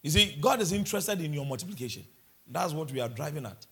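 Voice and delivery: ordinary talk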